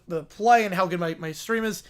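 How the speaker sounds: usual speaking voice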